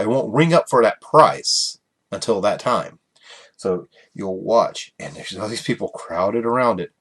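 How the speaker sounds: ordinary talk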